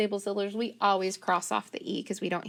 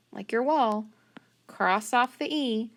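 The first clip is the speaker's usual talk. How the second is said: ordinary delivery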